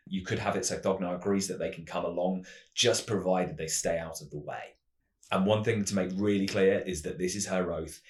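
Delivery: ordinary delivery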